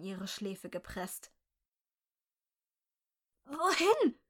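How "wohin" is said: high, loud, tearful croak; fearful croak. A narrator's reading. Abducted woman asking her captor, panicked high croak. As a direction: croak it out on the edge of sobbing. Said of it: "krächzte sie".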